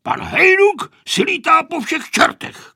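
loud, high, angry yell; grandpa shouting. A narrator's reading. Grandpa yelling back at the children, shouting. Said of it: "křičel děda Kozelka".

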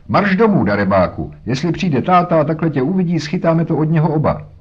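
put-on high, loud scolding shout at the boy; shouting after a runaway child; yelling mother. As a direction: threaten him with father's anger, loudly. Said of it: "Žaku křičela".

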